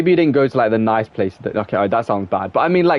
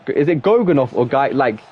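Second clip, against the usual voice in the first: ordinary talk, nothing marked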